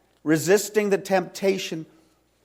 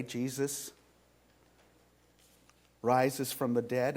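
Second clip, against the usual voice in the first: ordinary talk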